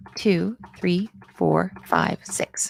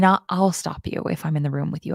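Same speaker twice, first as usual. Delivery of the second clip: ordinary talk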